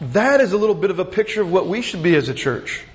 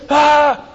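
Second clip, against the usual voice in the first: anguished cry; 8 semitones higher, 8 dB louder than usual. Acted. Crowd stops its ears.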